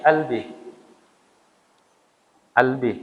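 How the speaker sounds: "albi" with a glottal stop for qaf, Egyptian style; Egyptian-accent "albi". Shown as a demonstration. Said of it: "logat Mesir".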